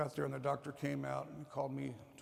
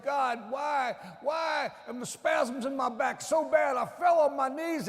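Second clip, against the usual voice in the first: loud, higher, slow anguished cry; 9 semitones higher, 8 dB louder, slower than usual. Retold or imitated. Grieving father crying out to God at the grave. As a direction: wail it at the sky. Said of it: "crying out loud and saying".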